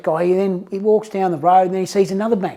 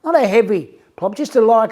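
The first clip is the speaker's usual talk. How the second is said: ordinary talk, nothing marked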